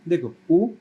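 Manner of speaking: ऊ said short and clipped, rising at the end; a quick one-beat ऊ; short vowel demo. Shown as a demonstration. Teacher showing students the short ऊ, brief. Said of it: "ऐसा इसका उच्चारण है".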